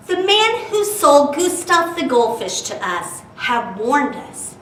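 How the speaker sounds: lively storytime narration with bouncy rhyme; animated read-aloud voice; reading to children. A narrator's reading. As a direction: read it like a picture book to kids.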